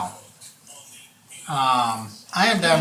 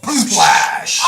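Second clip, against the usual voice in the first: loud, higher sound-effect words; imitated splash; 5 semitones higher, 8 dB louder than usual. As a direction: burst out the impact with gusto. Imitates troll hitting the water.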